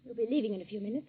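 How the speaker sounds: very soft, withdrawn voice; subdued. Acted. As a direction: keep it small and guarded. Shaken wife facing husband at breakfast.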